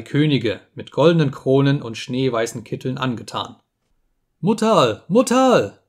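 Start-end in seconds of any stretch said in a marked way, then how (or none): 4.4-5.0 high frightened call to mother; a child's first desperate cry. A narrator's reading. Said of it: "wollte das Schluckerle schreien". 5.1-5.8 much higher, louder second cry of panic; the call breaks upward in fear; frantic child screaming for mother; desperate cry for mother. A narrator's reading. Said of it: "wollte das Schluckerle schreien".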